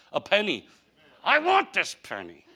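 ordinary delivery

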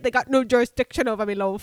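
loud mock British accent; fake British accent. Claiming she can say it, loud fake British accent. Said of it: "my accent's fake".

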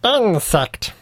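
high, otherworldly bug voice; insect voice. Pretend. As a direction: squeak it like a tiny bug. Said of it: "That's my insect voice".

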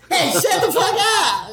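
high, slow laughing mock shout; drawn-out mock yell, half laughing. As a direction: ham up an outburst through laughter. Mock outburst at director.

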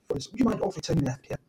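lightly put-on posh British accent, like a genteel old sergeant; posh Sergeant Wilson. British guest imitating a posh English sat-nav, lightly clipped posh accent. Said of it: "you can get a Sergeant Wilson voice".

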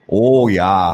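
a heavy Minnesota "Oh yeah," with long round vowels. Shown as a demonstration. Host showing off his Minnesota accent, heavy Minnesota vowels. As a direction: lean into a thick Minnesota "Oh yeah,". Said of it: "those really heavy".

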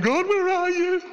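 high, pleading mock complaint; a high, exasperated plea; frustrated cry to God. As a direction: play the frustrated believer calling out to God. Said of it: "getting all frustrated and going".

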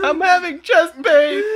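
meme line blurted through laughter; loud slow quote cracking up; laughing quote. Retold or imitated. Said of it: "made me think of the".

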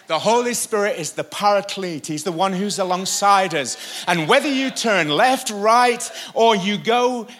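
loud, high, building sermon delivery; full-force preacher in full flow; preaching. Real, not acted. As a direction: drive it upward to a ringing peak.

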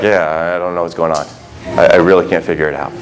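low, soft, fast dopey voice; hammed-up dim bewilderment; clueless disciple. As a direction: mumble it like you truly have no clue.

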